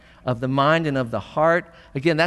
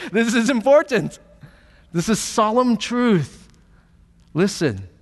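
higher, louder solemn declaration with a laugh breaking in; solemn emphasis; 7 semitones higher, 5 dB louder than usual. Shown as a demonstration. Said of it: "but he'd say".

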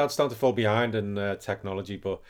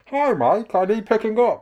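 high, odd little creature voice, pitched up; squeaky pervy Yoda asking for a lift; 10 semitones higher, 5 dB louder than usual. Pretend. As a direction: play a cheeky Yoda calling his friend. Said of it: "pervy part of pervy Yoda".

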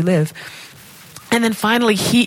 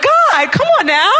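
high-pitched playful whine to God; exasperated protest; 14 semitones higher, 4 dB louder than usual. Acted. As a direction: protest up high, half laughing. Imitates her exasperated reply to God.

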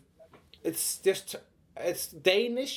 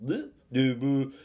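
put-on Norwegian accent. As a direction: bounce the pitch like cartoon Norwegian.

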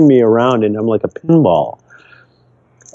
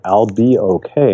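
ordinary talk, nothing marked

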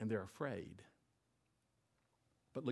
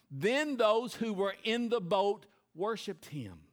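slow, weighted, louder reading; slow emphatic reading; 7 semitones higher, 8 dB louder, slower than usual. Shown as a demonstration. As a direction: read every word slowly, for weight. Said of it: "look at one thing in that verse".

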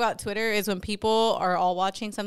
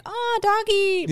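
high, drawn-out coo to a dog; mock cooing; 10 semitones higher, much slower than usual. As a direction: imitate fawning over a cute dog. Imagined daytime reaction to the dog.

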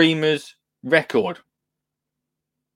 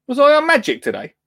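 surprised; 8 semitones higher, 3 dB louder than usual. Real, not acted. Disbelief at the news.

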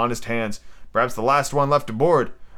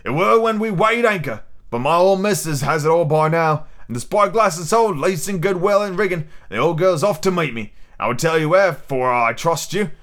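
put-on higher-pitched old sea cook; pirate cook, boastful; 4 semitones higher than usual. Silver confides his plan to a shipmate, boastful. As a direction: play a confiding buccaneer cook. Narrator voices the ship's cook.

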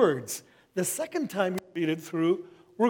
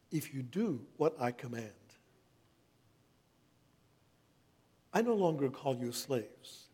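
hushed, reverent scripture reading. Acted. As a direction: read the words as Jesus' tender farewell. Reads Jesus' words aloud.

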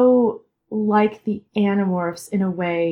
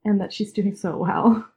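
normal talking voice

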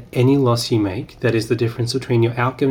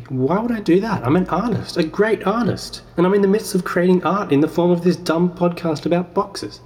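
higher-pitched, indignant protest, hammed up; offended self-proclaimed artist; 7 semitones higher than usual. Playing himself brushing off his inner voice, hammed-up indignation. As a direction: play the wounded great artist scoffing at the idea. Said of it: "That's bad improv".

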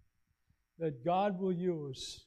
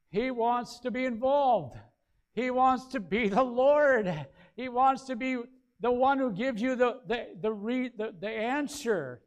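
louder, rising preacher's build; preaching at full force; 5 semitones higher, 8 dB louder than usual. Acted. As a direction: push it home to the congregation.

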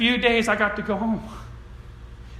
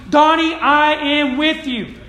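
higher, louder and slowed, a gentle divine promise; voice of God; 5 semitones higher, 5 dB louder than usual. Pretend. God speaking to young Donnie.

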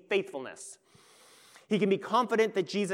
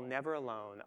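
much softer, hushed, Never alone said gently; soft tender promise; 5 semitones lower, 9 dB quieter than usual. Acted. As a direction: lay it down softly like a comfort. Voiced Jesus ends tenderly.

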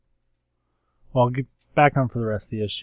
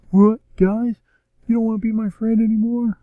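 put-on high, hurt teen voice, faster at the end; young Cyclops; 13 semitones higher, 7 dB louder than usual. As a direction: play a wounded kid left out by his friends. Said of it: "young Cyclops is like".

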